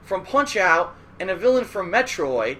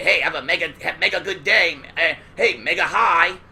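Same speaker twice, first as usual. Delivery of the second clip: lightly voiced cartoon Mega Man; 3 dB louder than usual. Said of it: "he would talk like this".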